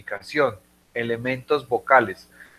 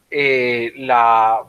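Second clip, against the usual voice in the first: ordinary talk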